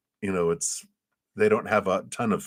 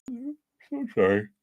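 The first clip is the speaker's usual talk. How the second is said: yawning apology; 4 dB quieter, much slower than usual. Real, not acted.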